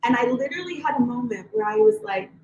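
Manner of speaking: ordinary talk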